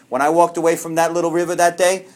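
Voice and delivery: normal talking voice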